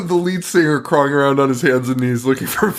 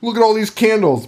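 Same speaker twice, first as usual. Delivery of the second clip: raised pitch, imitating keen excitement; mock excited; 7 semitones higher than usual. Woman showing blind girl the candles, mock excited. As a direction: act out being thrilled by candles. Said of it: "isn't excited about the candles".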